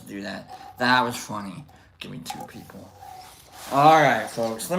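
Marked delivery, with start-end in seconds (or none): 2.0-2.9 whispered, low repeat of a joke. Retold or imitated. Host repeats the chatter's joke to himself, whispering. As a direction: say it under your breath, whispering.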